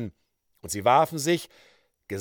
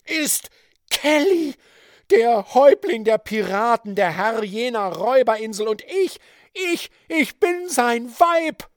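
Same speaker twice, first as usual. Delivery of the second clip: wild triumphant woman; 10 semitones higher, 7 dB louder than usual. A narrator's reading. Georgine's triumphant reveal.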